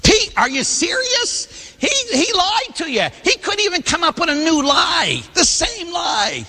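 a scandalised sister scolding Pete; incredulous nun nearly shouting at the lie; exasperated nun. Retold or imitated. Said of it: "'cause Sister, like".

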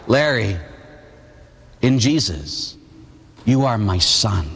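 slow, low, long pauses between phrases; solemn reassurance, weighting each phrase; tender voice of God. Retold or imitated. God's words heard deep within, retold.

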